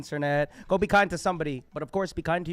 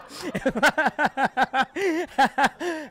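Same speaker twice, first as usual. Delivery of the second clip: high, loud helpless laughter; laughing fit; 12 semitones higher, 11 dB louder than usual. Real, not acted. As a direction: crack up and can't stop. Host cracks up mid-impression.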